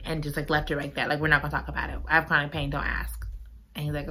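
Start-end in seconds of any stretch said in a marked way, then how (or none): 1.2-3.3 low, soft imitation of shutting it down; curt dismissal. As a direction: close the subject quietly, as the character would. Chloe shuts the subject down.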